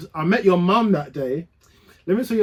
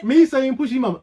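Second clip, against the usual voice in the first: ordinary talk, nothing marked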